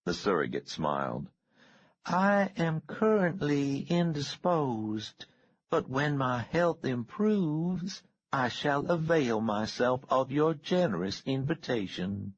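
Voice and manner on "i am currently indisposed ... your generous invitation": higher, slow genteel Southern belle, a bit mad; simpering refined politeness, drawn out; Southern lady. A narrator's reading. Miss Fuller replying through surrogate.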